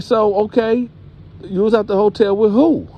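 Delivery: high-pitched acted grilling; demanding. Grandma asks who was at the hotel, demanding. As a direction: press for the name as an indignant mother.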